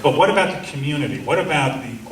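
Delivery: ordinary talk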